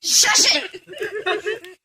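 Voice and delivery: high and loud, laughing through it; mock scolding with a laugh; laughing shush. Real, not acted. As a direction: shush them while laughing. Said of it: "telling off a heckler".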